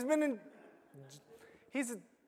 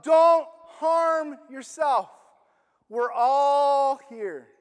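slow, loud drawn-out shout; yelling out; 5 dB louder, much slower than usual. Acted. Paul shouting to the jailer to stop him, slow loud call. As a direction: call it out slowly across the prison. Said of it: "Paul yells out".

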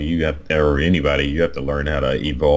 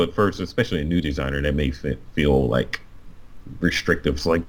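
ordinary delivery

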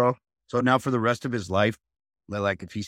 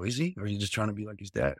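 quiet pointed challenge; 9 dB quieter, faster than usual. Retold or imitated.

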